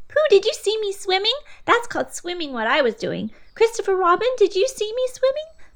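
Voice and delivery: very high excited squeak. A narrator's reading. Roo asking everyone after climbing out, excited squeak. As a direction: squeak it, bursting with pride.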